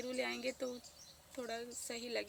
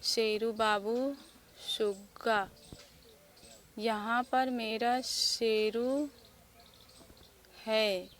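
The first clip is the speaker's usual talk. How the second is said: put-on slow, drawn-out, loud reading of a child's note; mother reads son's words on the grave marker, slowly; reading the flag aloud; 8 dB louder, much slower than usual. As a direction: read each pet name out slowly, lovingly. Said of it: "दूसरी तरफ भी वही लिखा है".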